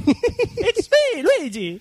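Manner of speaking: voz aguda de Luigi italiano, muy exagerada; acento de Luigi. Pretend. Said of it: "no hay huevos a leer el correo con el acento de Luigi". (acento italiano de Luigi, agudo y exagerado)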